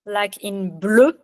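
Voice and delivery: bleu with a rounded French eu, lips pushed out. Shown as a demonstration. Teacher models bleu for a learner, slow rounded eu. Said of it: "you can also write it E U".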